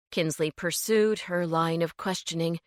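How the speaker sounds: plain reading voice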